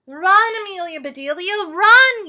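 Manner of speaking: very high, loud yell; yelling boys. Acted. Boys yelling at Amelia Bedelia to run, loud excited yell. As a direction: scream her on toward first base. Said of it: "yelled the boys".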